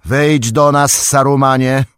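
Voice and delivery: high, loud command; commanding shout. A narrator's reading. Gandalf orders Saruman down, shouting commandingly. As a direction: order him out like a wizard. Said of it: "krzyknął Gandalf głośno i rozkazująco".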